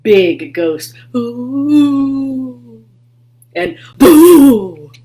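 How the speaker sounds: loud, high ghost boo; ghostly shout. Acted. Gilbert haunting the tiny king and queen, loud ghostly boo. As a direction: shout it to scare. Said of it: "little Gilbert shouted".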